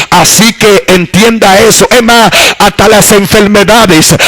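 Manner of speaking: lightly heightened pulpit voice; fervent preaching. Acted. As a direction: preach it to the congregation. Preaching to the congregation.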